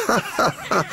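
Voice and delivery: high, mocking wagon laughter; ha ha ha laughed high and loud; loud laughing. A narrator's reading. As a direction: cackle at him gleefully. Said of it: "Głośno śmiały się wagony".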